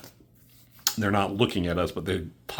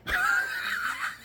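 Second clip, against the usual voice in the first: squealing laugh; 5 dB quieter than usual. Real, not acted. Laughs over transition.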